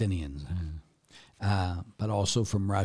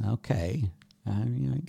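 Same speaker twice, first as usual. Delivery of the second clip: usual speaking voice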